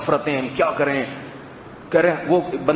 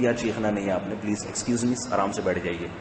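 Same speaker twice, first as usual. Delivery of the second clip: much softer and lower, polite and unhurried; a gentle manager quietly settling someone down; imitating a calm manager; 7 semitones lower, 8 dB quieter, faster than usual. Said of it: "کہتے ہیں نا ایسے".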